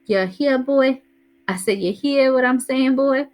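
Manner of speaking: hammed-up Southern hillbilly drawl, teasing the kids; hillbilly accent. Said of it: "Dad did an imitation of a hillbilly accent".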